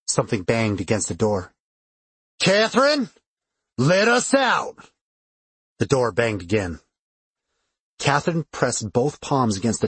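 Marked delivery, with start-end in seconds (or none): 2.4-4.9 high, drawn-out yell; demanding shout. A narrator's reading. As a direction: demand to be let out, stretching the words. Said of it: "sounded like a man again".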